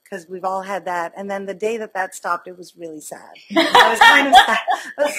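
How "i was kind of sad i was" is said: laughing. Real, not acted. Laughing admission of sadness.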